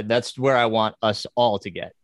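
ordinary talk, nothing marked

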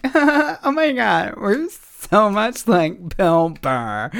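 high, slow, gushing mock girl voice, laughing; mock fan girl. Imitating annoying girls at the show, gushing mock voice.

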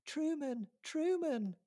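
high distant calling voice; calling from afar. A narrator's reading. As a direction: call out from down the street. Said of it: "calls a familiar voice".

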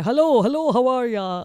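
lightly put-on Midlands greeting; actor's Midlands accent. Imitating an actor seen in a play, light Midlands accent. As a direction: imitate a Midlands character saying hello. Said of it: "I'm gonna be him tonight".

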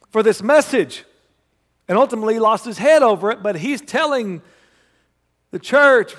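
high, loud, driving sermon voice; fiery preaching. Acted. Pastor stirring his congregation about Paul's joy, full-force preaching. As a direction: preach it hard to the room.

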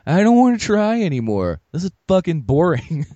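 high, fed-up whine; bored whine. Retold or imitated. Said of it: "I went".

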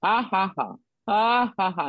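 deliberate "ha, ha, ha" at the devil; imitated mocking laugh. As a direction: act out laughing in the devil's face. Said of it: "he would just start laughing at him".

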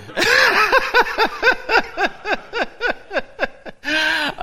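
long, high peal of laughter; high cackling laugh that keeps rolling; joyful laughing. Real, not acted. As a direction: break into a real, helpless laughing fit. Preacher overcome with joy after preaching.

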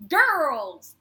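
put-on loud, playful call of "Girls!"; mock shout. Xander greeting the girls, a loud mock call. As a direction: bellow it like a goofy greeting. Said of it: "Xander goes".

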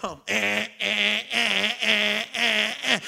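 hotel guest stammering into the phone; flustered caller. Retold or imitated. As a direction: fumble for words on the phone. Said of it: "I said".

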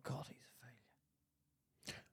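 slow, breathy secret whisper; whispered thought. Retold or imitated. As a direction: hiss it like a private judgement. Whispered thought about pub guy.

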